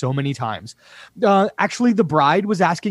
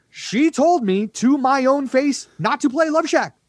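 exasperated rant; 12 semitones higher than usual. Real, not acted. Ranting about guests.

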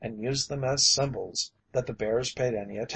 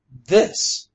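loud, higher outraged cry; 4 semitones higher, 9 dB louder than usual. A narrator's reading. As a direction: thrust the word out in outrage. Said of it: "he cried".

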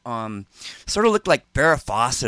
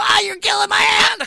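high, loud cry of pain; pained shriek; 3 dB louder than usual. Retold or imitated. Donnie shrieking at friend squeezing his hand, high pained shout. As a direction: howl as if your hand is being crushed.

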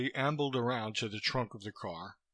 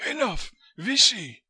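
high, loud foreign dismissal; wheezy fat man, abrupt and final; Koitska, curt; 11 semitones higher, 8 dB louder than usual. A narrator's reading. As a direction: end it curtly in a high wheezy voice. Fat Koitska ends the interview.

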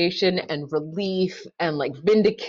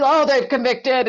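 panicked thought; 6 semitones higher, 5 dB louder than usual. Retold or imitated. Panicked inner voice reading jurors.

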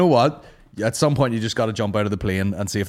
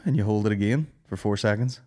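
normal talking voice

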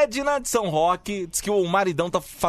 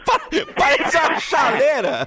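louder, breaking into laughter; laughing; 8 semitones higher, 4 dB louder than usual. Real, not acted. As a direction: laugh through the line. Laughing at whistle noise.

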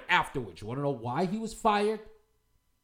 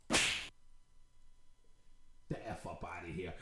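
ordinary talk

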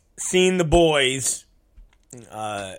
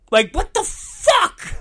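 outraged disbelief yelled out; high slow yell of disbelief; incredulous shout. Real, not acted. Outrage at signing bootlegs.

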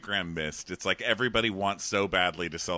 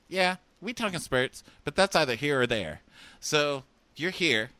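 a hint of a cheesy pickup; mock Eric; 6 semitones higher, 4 dB louder than usual. Eric telling Cassandra she is here, slowed and higher. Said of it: "And he goes".

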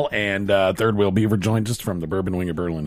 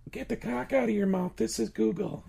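fake Google voice; 10 semitones higher, 11 dB quieter than usual. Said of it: "That was me".

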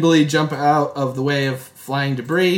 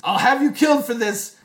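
high, strained furious threat; 10 semitones higher than usual. Acted. Justino threatening Smaldoni, high and furious. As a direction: spit the threat.

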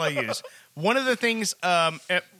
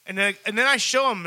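ordinary talk

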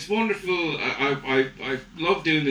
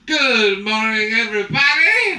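slow, loud, rising intercom greeting; hammed-up tipsy pilot announcement; drunk pilot intercom; 4 semitones higher, 6 dB louder, slower than usual. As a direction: greet the cabin far too cheerily. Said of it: "the pilot comes on the intercom".

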